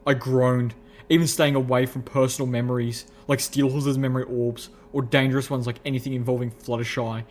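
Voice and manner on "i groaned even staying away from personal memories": ordinary delivery, nothing marked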